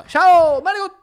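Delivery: Italian-American "manigot" with a loud high "Ciao"; hammed-up Italian order, "Ciao" thrown out high; playful mock Italian accent. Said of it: "how to say that, like, in an American way".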